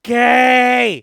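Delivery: loud high delighted yell; shout. Real, not acted. Player yelling at a flirty line. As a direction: blurt it out at full volume.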